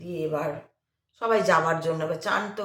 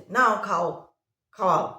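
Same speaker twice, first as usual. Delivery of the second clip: irritated; 4 semitones higher, 10 dB louder than usual. Retold or imitated. Irritated man hands over sweets.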